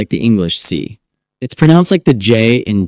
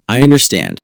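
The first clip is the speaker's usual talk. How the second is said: ordinary delivery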